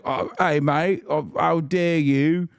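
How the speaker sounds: high-pitched, indignant caricature of a Brit; mock British accent. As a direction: put on a hammed-up British whine, drawing out "how dare you". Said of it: "British people are like".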